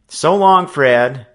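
called out loud and high; calling out. A narrator's reading. Boy calling goodbye to friends, high and loud. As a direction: call it across the street to a friend. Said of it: "he called as he turned his wheel".

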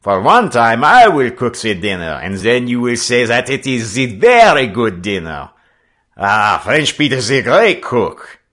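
put-on loud, high French-accented skipper; calling out. A narrator's reading. French skipper promising his crew a dinner, calling out jovially. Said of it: "he called out".

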